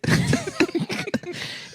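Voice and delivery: squealing giggle; high falsetto laugh. Real, not acted. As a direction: cackle in falsetto. Laughing at own bit.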